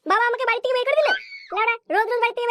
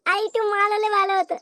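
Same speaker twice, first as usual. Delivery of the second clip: usual speaking voice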